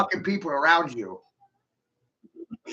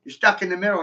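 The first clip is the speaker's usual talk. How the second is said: normal talking voice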